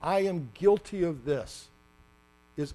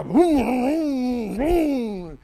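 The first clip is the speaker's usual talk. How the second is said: engine noise; 6 semitones higher, 6 dB louder, much slower than usual. Retold or imitated. Imitating car engine.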